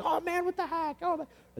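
very high-pitched mock exasperation; exasperated driver. Acting out traffic frustration.

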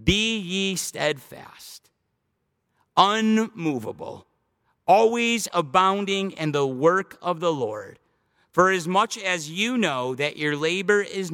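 slow, measured, reverent reading of the verse; drawn-out pulpit reading; solemn scripture reading. Acted. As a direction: read each phrase slowly like a benediction.

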